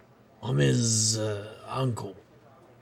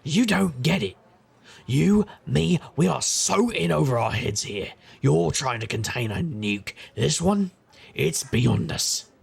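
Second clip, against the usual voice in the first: louder, higher, pressing; urgent warning; 4 semitones higher, 4 dB louder, slower than usual. Acted. Uncle pleading with his younger self, louder and urgent. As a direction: hammer home the danger.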